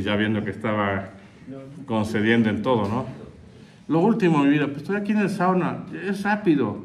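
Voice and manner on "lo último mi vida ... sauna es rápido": higher, fast wife squeezing in one more; hurried wife. Retold or imitated. Wife on the phone asking one last favour, higher and quick. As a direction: rush it out, coaxing and pitched up.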